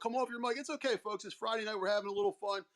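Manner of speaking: normal talking voice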